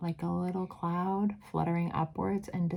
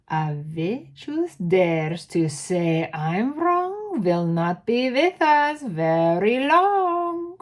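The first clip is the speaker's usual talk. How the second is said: put-on slow, loud threatening witch accent, rising higher; Grand High Witch accent; 4 semitones higher, 7 dB louder, slower than usual. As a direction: menace the crowd slowly in the accent. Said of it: "do the accent".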